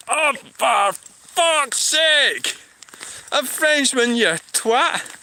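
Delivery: drawn-out, high-pitched exasperation; exasperated outrage. Real, not acted. Streamer reacting to a viewer calling him French, exasperated. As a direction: groan it out high, in comic disbelief.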